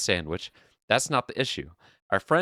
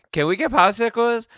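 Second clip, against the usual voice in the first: put-on much higher, louder kid begging; whiny kid; 14 semitones higher, 7 dB louder than usual. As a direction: plead like a toddler in the store. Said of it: "kept saying".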